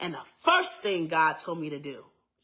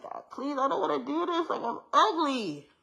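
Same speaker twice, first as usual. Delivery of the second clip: high, whiny mock crying; retold tearful plea; 8 semitones higher than usual. Young girl begging God at the mirror, retold tearful plea. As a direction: plead upward, stretching out the last word. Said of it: "And I cried. I was like".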